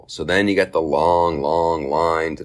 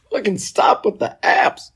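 exasperated. Retold or imitated. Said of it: "And I'm like".

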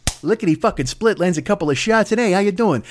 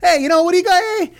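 much higher, strained huckster whine; Trump as Mainway; 10 semitones higher, faster than usual. Retold or imitated. Comic voicing the president as the shady toy seller, high strained. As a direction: pitch it high and cracking. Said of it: "That's who fucking Trump is".

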